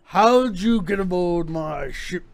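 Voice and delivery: slow, drawn-out pirate captain challenge; half-orc pirate captain. Acted. Pirate captain questioning the stowaway on his ship, slow and in character. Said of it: "a typical pirate captain".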